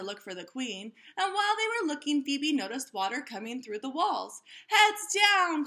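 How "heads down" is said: loud, high warning call. A narrator's reading. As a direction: shout a quick warning. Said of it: "called the Frizz".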